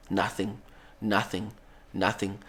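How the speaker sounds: tense hush. Acted. Tense wait outside the church.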